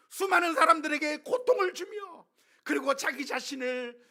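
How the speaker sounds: loud, high-pitched impassioned sermon; sustained pulpit shout, pitched high; full-force preaching. Real, not acted. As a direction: cry out searching questions to the congregation.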